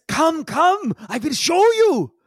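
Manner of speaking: high-pitched, excitable creature voice; Yigsel, eager summons. Acted. Yigsel calls the party to follow, high and excited. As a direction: beckon them grandly in a high character voice.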